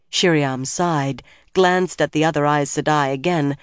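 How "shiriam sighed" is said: plain reading voice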